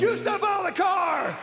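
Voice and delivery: high mock shout back at a cop; shrieked comic defiance; defiant driver.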